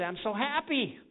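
mock joyful, laughing through it; beaming imitation of a happy believer. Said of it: "you say".

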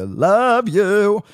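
high, loud, drawn-out singsong elf; Christmas elf. Pretend. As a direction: sing it like a giddy elf. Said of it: "sounding like the elf just then".

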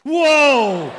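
sudden loud exclamation; startled whoa. Real, not acted. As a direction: yelp in surprise. Bike seat drops suddenly.

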